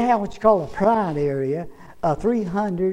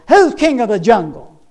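loud, raised-pitch lion bellow; mighty roar; 5 semitones higher, 11 dB louder than usual. Acted. Lion challenging a rabbit, mighty roaring shout. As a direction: roar the challenge at a small animal. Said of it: "He let out a mighty roar".

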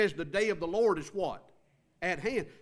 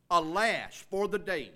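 ALAS stretched out and punched, then falling; emphatic exclamation. Shown as a demonstration. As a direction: cry "Alas" slowly with exclamation-point emphasis. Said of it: "when you see that exclamation point".

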